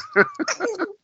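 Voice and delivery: high squealing dinosaur noise. Real, not acted. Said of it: "He sounds like a dinosaur".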